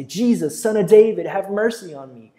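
loud, high call on "David"; crying out. A narrator's reading. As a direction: call out desperately to Jesus. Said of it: "he began to cry out and say".

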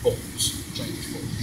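low, slow, soft film-spy line; Sean Connery. Acted. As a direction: intone it like the screen spy. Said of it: "The one where he's like".

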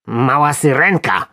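dry mocking retort from the worm; sarcastic snarl. A narrator's reading. Worm answering what will be caught, sarcastic jab. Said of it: "warknął robak z przekąsem".

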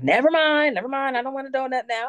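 put-on high childlike backpedal; imitated little girl. Mommy imitating her daughter giving up the donut, high and childlike. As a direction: mimic a child's high, hasty retreat.

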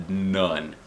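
usual speaking voice

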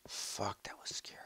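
whispered, breath held; quiet scared whisper; shaken whisper. Real, not acted. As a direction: whisper it, still rattled. After near death.

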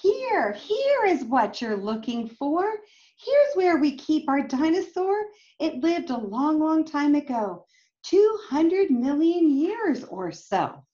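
put-on lively storybook read-aloud for kids; reading to children. A narrator's reading. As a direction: read it like a picture book to a class. Reading picture book aloud.

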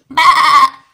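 loud, bleating sheep imitation. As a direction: bleat out a playful baa.

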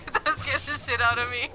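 normal talking voice

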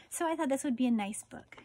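ordinary delivery, nothing marked

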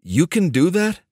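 high-pitched disbelieving question; incredulous. A narrator's reading. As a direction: ask it as if you can't believe it. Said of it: "sounding incredulous".

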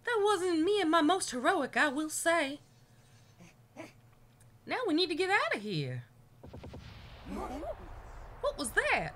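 put-on high-pitched southern-accented character. Rescued character urges escape, in a southern accent. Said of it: "gave that professor a southern accent".

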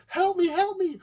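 high, loud plea for help; mock damsel in distress. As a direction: cry out like a helpless damsel.

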